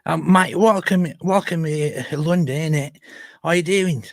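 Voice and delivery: cheerful London geezer voice, played for laughs; mock London accent. Friend parodying Londoners with a mock London accent.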